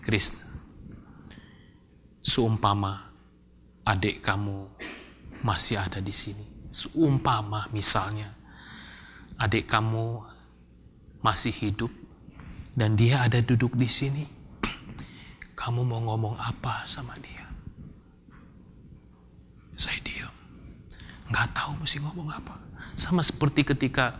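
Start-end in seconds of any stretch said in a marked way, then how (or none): 0.0-17.5 low, soft, halting question; hushed, careful counsellor voice with pauses; gentle counsellor. Retold or imitated. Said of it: "Terus dia ngomong lagi". 19.8-22.5 very soft, near-whispered admission; barely voiced, stunned murmur; hushed helplessness. Reliving being lost for words.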